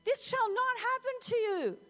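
loud, very high protest; emphatic rebuke. Acted. Peter refusing to let Jesus suffer, vehemently rebuking. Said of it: "he began to rebuke him".

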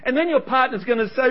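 ordinary talk, nothing marked

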